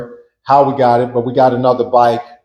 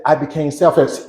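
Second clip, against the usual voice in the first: ordinary delivery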